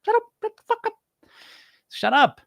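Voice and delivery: very high, squawky put-on coach voice; mock scolding in a tiny high pitch. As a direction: squeal it like a comic little voice. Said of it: "my coach voice says".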